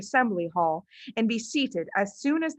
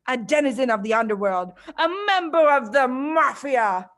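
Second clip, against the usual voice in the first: booming headmistress; 3 semitones higher, 4 dB louder than usual. Acted. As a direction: savour each insult like a villain. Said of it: "boomed the headmistress".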